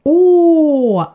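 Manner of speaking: O with chin down and round mouth; Swedish Å. Shown as a demonstration. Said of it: "like that".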